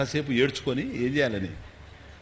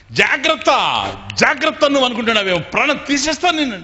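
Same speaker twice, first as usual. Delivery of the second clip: very loud, high shouting; a staged threat thrown toward the leaving guest; mock furious threat; 10 semitones higher, 8 dB louder than usual. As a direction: scream the warning after him. Husband shouts threats as guest leaves.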